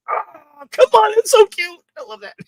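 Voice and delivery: high-pitched squeal of delight over a cute scene; gushing delight. Real, not acted. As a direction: gush about how cute it is.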